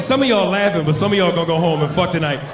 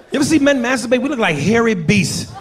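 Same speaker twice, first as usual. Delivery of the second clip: ordinary talk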